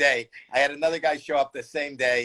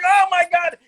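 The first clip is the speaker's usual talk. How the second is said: high, loud panicked cry; retold hysterical yell; screaming; 6 dB louder than usual. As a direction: scream back in horror. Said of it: "then I start screaming and screaming".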